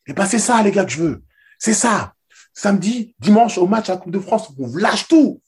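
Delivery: high, loud rallying cry; fired-up coach. Retold or imitated. Coach firing up his players before the cup final, loud and high.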